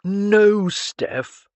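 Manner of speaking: dry, firm correction of a younger relative. A narrator's reading. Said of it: "Clarence said dryly".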